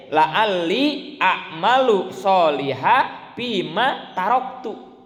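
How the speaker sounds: slow, chanted Arabic verse; Quranic recitation. Acted. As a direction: draw out each Arabic word like a recitation. Arabic verse of the dying man.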